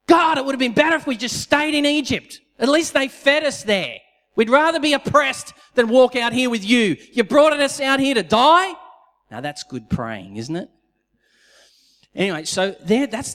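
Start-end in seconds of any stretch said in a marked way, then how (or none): 0.1-8.7 high, loud whiny complaint; whining complaint. Retold or imitated. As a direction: whine at God like a spoilt crowd. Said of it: "their intercession went like this".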